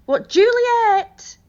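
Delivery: high, slow call across the house. Acted. Nurse calling for Juliet somewhere in the house, loud call. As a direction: draw the name out to reach her.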